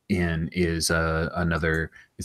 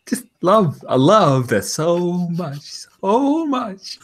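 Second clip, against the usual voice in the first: delighted squeal; 11 semitones higher, slower than usual. Real, not acted. GM delighted by player's idea.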